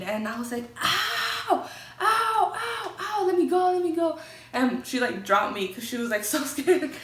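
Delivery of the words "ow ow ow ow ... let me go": high, loud retold pain cries; screaming in pain. Begging her friend to let her go, high pained screams. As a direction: relive the agony, crying out to let go. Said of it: "And I started screaming".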